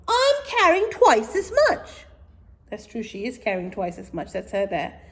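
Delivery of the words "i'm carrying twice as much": indignant sister. Acted. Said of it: "said Madge".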